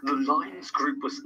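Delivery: slow, hushed narrator voice; mock narrator. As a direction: whisper it slowly like a trailer narrator. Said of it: "got our own narrator here".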